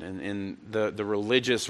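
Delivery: ordinary talk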